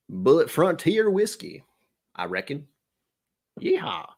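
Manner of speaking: playful put-on cowboy twang; cowboy accent. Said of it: "I slipped into a cowboy accent".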